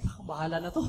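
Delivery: soft, dropped, giving-up murmur; resigned sigh. Retold or imitated. Preacher recalling surrender before the talk, softly. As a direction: let it go under the breath.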